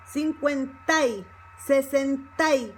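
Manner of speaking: "cincuenta y" ending in a stressed "tai"; Y joined to A. Shown as a demonstration. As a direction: glide "a" into "y" in "sesenta y". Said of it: "becomes together with the A".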